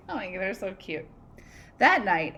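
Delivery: normal talking voice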